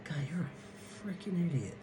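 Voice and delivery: under-the-breath whisper; whispered aside. Retold or imitated. Coach whispering about a foolish parent, under his breath. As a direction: whisper it so they can't hear.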